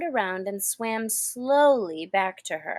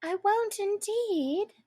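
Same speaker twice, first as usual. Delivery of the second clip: put-on high, soft girlish promise; hasty Alice; 11 semitones higher, 5 dB quieter, slower than usual. A narrator's reading. Alice hurriedly promises the mouse. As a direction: promise quickly and softly. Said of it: "in a great hurry to change the subject".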